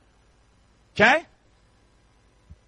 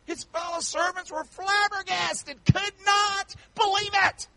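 high, impassioned storytelling preacher; full-force preaching; 10 semitones higher than usual. Acted. Preacher retelling the parable to the congregation, impassioned. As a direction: preach the parable with rising fervour.

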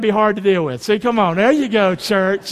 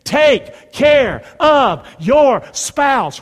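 extremely slow, loud, word by word; call-and-response; 5 semitones higher, 6 dB louder, much slower than usual. Shown as a demonstration. Preacher leading the congregation to repeat, word-by-word call. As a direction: shout each word separately for repeating. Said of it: "Can you say that with me".

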